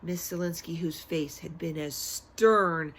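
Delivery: plain reading voice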